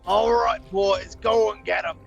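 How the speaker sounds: loud gruff orc command; rally the troops as an orc; orc boss voice. Acted. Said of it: "the orc goes ahead and says".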